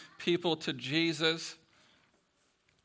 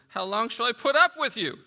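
exasperated rebuke; 7 semitones higher, 10 dB louder than usual. Acted. Jesus rebuking crowd, voiced by preacher.

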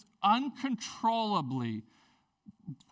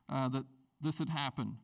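ordinary delivery

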